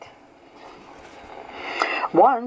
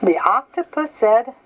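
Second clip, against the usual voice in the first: ordinary delivery, nothing marked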